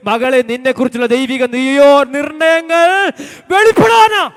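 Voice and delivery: builds from speaking to a high, loud shout; preacher swelling into a shout; rising preaching. Real, not acted. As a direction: drive the point home, climbing to a cry. Preacher rises to a climax.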